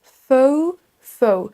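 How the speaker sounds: faux as English foh-oo, gliding O to OU; diphthong. Shown as a demonstration. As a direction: stretch faux from an O into an OO. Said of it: "c'est une diphtongue". (faux en diphtongue, O puis OU)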